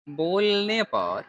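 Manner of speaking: drawn-out dictation while writing; slow dictation. Shown as a demonstration. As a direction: stretch "बोलने पर" slowly for note-taking. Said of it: "इसको हम लोग लिखेंगे".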